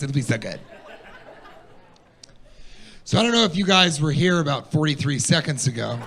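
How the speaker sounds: ordinary talk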